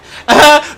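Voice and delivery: loud high laugh; burst of laughter. Real, not acted. Laughing at her own tight shirt.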